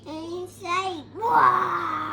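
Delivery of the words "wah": loud wailing "wah". Real, not acted. Toddler showing her mother the monster's wail. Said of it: "She goes".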